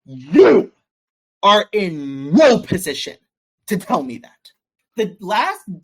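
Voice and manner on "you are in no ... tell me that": forceful retold rebuke, easing off at the end; retold angry outburst. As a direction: lay it down loudly, one word at a time. Retold outburst demonstrated to the pastor.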